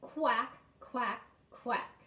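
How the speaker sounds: separate, punchy quacked words; duck quacks. Shown as a demonstration. Teacher shows how ducks talk, chanted quacks. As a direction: quack like a duck, one at a time. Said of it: "do it with me".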